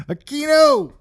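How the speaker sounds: high, loud mock call-out; playful holler. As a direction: holler the name like hailing a pal. Hollers the name as a bit.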